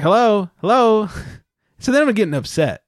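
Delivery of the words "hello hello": slow, raised hello hello; calling out. Retold or imitated. Calling to the woman in line, slow raised voice. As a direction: draw out each hello to get her notice. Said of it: "so I'm like".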